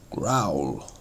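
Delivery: low rumbling growl, trailing down. Shown as a demonstration. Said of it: "bring out the meaning of the word by its sound".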